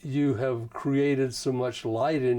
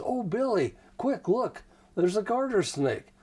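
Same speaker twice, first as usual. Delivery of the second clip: put-on higher-pitched, eager call to a child without shouting; delighted mother; 7 semitones higher than usual. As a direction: voice a mother sharing a fun discovery. Calm mother voiced, calling her child.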